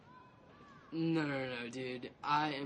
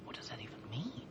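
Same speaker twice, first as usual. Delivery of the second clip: breathy whispered aside; 4 semitones lower, 8 dB quieter than usual. Acted. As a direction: whisper it behind his back.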